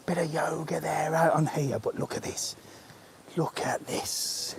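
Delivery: hushed whisper so the yoga class can't hear. Real, not acted. Vlogger whispering near people doing yoga, hushed. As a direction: whisper it, keep it secret.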